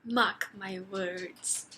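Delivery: slow, ominous warning; mock-ominous vow. Reader predicting the king will be trapped, mock-ominous. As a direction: draw it out like a solemn threat. Vowing the king's downfall.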